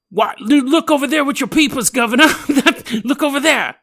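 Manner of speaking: a high, loud hammed-up British accent, laughing through it; hammed-up British bloke. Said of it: "And you hear some guy go".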